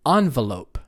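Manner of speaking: "envelope" said ON-velope; first-syllable stress. Shown as a demonstration. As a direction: hit ON at the start of "envelope". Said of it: "stress the first syllable".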